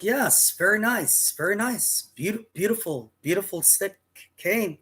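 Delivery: high, polite, eager voice; imitating his younger self. Storyteller voicing his nineteen-year-old self to the saleswoman, high and polite. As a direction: humour the saleswoman sweetly. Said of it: "I was like".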